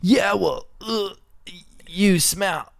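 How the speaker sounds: put-on high, silly childish retort; lame comeback. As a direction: whine it like a kid with no comeback. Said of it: "instead you're like".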